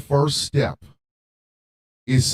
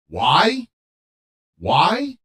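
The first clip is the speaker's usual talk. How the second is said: higher, louder repeated why; loud demand; 8 semitones higher, 5 dB louder than usual. Real, not acted. Host demanding an answer from viewers, loud. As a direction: throw the question out hard.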